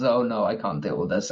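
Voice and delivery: low, soft mutter to himself. Acted. Player voices Daniel dreading the morning, low mutter. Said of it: "Daniel just mutters".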